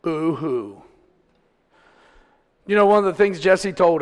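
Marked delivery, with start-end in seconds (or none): 0.0-0.8 mock crying. Mocks self-pitying spanked child.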